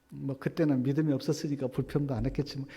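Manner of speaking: ordinary delivery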